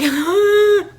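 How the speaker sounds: high mock sob; hammed-up crying gasp, very high; imitated crying. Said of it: "And so I'm crying".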